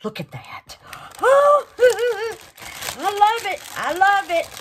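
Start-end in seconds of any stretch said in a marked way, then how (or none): 1.2-2.4 sharp breathy gasp, then a high giggle; thrilled gasp at the gift; delighted gasp and laugh. Real, not acted. 3.0-4.5 high and loud, gushing; thrilled, higher and louder; excited. Real, not acted. Delighted over gift.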